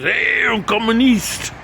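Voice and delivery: high, drawn-out jeering call; mock call-out. Man at the bar hailing a stranger's North Korea t-shirt, high drawn-out call.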